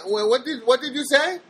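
mock her baffled question; imitating Janet. Mimicking confused caller Janet.

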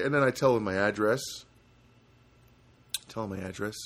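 normal talking voice

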